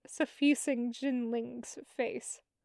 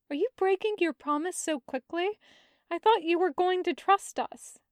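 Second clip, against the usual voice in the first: higher and fast, a light teasing scold; faintly scolding; 6 semitones higher, 4 dB louder, faster than usual. Acted. Brother faintly scolding Zhang Cheng for breaking his promise. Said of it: "faintly scolding".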